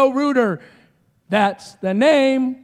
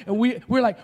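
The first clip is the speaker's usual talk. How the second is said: normal talking voice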